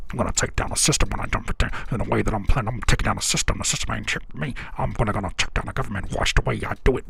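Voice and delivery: low, fast, droning mock of the rapper; mocking monotone rapper. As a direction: drone it out like a flat, self-serious rapper. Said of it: "Imagine me".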